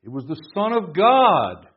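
slow build that lands hard on "God"; stress on "Son of God". Shown as a demonstration. Preacher showing an emphasis in a verse, stress on "Son of God". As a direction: hit "Son of God" loud, high and drawn out. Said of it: "where you put the emphasis".

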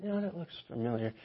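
soft, knowing dad voice; hushed, slyly piecing it together; a touch of Sherlock Holmes dad; suspicious dad. Retold or imitated. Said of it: "he's like".

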